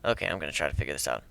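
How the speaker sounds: ordinary delivery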